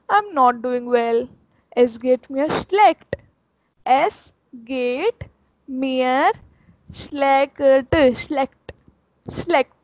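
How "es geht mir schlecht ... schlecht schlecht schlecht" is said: slow, broken into chunks, schlecht repeated; slow word-by-word demo. Shown as a demonstration. Teacher breaking up a phrase for learners, slow. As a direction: break the phrase apart slowly. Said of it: "word by word demo".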